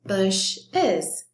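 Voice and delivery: "bushes" slowly split as bush, es; two separate beats on "bushes"; broken into syllables. Shown as a demonstration. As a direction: sound out "bushes" in two parts. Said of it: "it becomes bushes".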